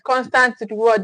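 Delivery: ordinary delivery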